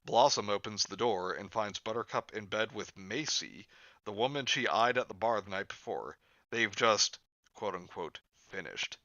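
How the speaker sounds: normal talking voice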